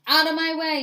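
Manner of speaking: loud, high demand to get through; yelling. A narrator's reading. Angry patient bursts into the office, yelling. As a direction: yell it, pushing through. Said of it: "yelled a woman".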